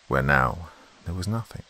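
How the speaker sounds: ordinary delivery, nothing marked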